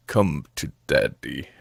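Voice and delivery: very low, slow, hushed creep; creepy daddy. Retold or imitated. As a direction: draw it out low and soft, imitating a creep. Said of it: "creepy thing ever, but just the way he says".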